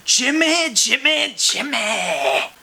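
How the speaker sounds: high sing-song call of Jimmy, Jimmy. Acted. Calling out Jimmy's name.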